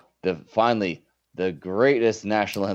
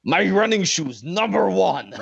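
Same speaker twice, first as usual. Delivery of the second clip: mock Iron Sheik; 10 semitones higher, slower than usual. Telling kitchen staff about his shoes, mock Iron Sheik accent. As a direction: brag about the shoes like a wrestler. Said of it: "And I was like".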